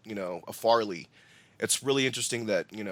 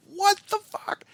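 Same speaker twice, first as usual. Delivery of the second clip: very high, louder mock outburst; stunned reaction; 5 dB louder, slower than usual. Podcaster recalling his reaction to the cartwheel stunt, stunned disbelief. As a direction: blurt it in high-pitched disbelief. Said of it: "I'm just like".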